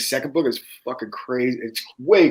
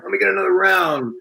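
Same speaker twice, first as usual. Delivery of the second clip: a higher, put-on drunk swagger; higher put-on voice, ordering a round like a big shot; 6 semitones higher than usual. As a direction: play your cocky drunk self ordering. Retelling his drunk self.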